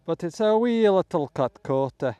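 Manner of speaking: put-on terrible Scottish accent. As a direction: do a badly faked Scottish accent. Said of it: "forgive my terrible Scottish accent".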